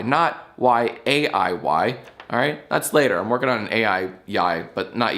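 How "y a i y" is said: very slow letter-by-letter spelling of the wrong version; deliberate, drawn-out letters; spelled out. Shown as a demonstration. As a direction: separate each letter for contrast. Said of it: "and spell it right".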